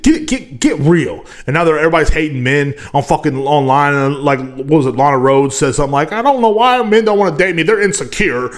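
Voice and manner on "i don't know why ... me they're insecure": mock complaint. Mocking Lana Rhoades quote.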